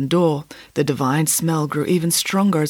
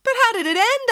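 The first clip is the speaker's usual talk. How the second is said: put-on higher, eager kid voice; child asking. A narrator's reading. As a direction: ask like a curious child. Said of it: "asked Michael".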